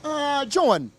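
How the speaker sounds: ordinary talk, nothing marked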